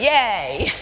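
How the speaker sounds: laughing little yay; happy cheer. Shown as a demonstration. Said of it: "talk to each other and say".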